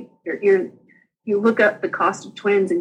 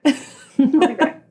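drawn out and higher with a laugh; laughing sing-song goodbye; 6 semitones higher, slower than usual. Retold or imitated. Telling coworkers she'll be back, laughing sing-song. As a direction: tease it out slowly while laughing.

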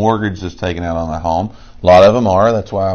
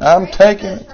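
excited; 9 semitones higher, 3 dB louder than usual. Real, not acted. Claims the win.

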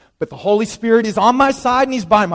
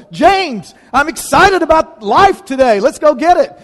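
higher and louder, bursting with joy; rally him like a joyful commander; commandingly joyful; 6 semitones higher, 5 dB louder than usual. Shown as a demonstration. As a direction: pastor voicing the Spirit, joyful and commanding. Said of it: "He's commandingly joyful".

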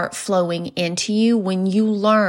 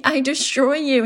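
Chris Farley impression; 6 semitones higher than usual. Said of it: "He's like".

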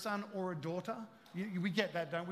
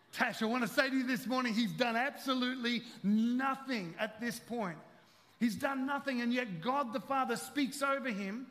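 louder, driving sermon voice; fervent preaching; 6 semitones higher, 6 dB louder than usual. Acted. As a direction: press the point home to Tash. Preaching at full force.

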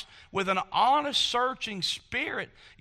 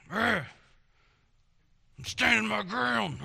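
defiant Pharisee grunt; slower than usual. Retold or imitated.

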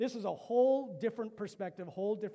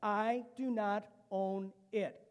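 slow declaration; 3 semitones higher, 3 dB louder, much slower than usual. Shown as a demonstration. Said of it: "Can you say that with me?".

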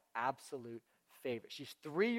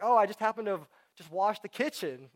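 higher, louder mock-casual boast; feigned casualness; 5 semitones higher, 9 dB louder than usual. Child hinting to parents about chores done, feigned casualness. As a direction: drop it in loudly, as if by chance. Buttering up parents before a request.

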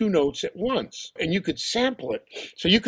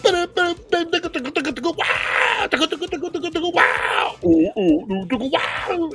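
high rhythmic wails and drum syllables; imitated scream jamming; 13 semitones higher, much slower than usual. Producer jamming with sampled screams, high vocal imitation. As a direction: mimic a sampler riffing on screams. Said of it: "the more Danny goes".

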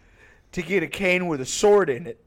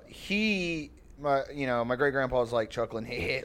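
ordinary talk

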